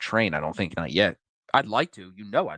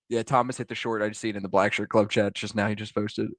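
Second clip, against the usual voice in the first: normal talking voice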